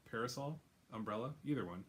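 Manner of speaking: ordinary talk, nothing marked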